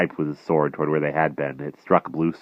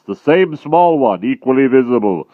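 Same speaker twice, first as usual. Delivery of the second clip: dim brutal giant; 10 semitones higher, 12 dB louder than usual. A narrator's reading. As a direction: drag each word out like a lumbering brute. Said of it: "cried Gallopus".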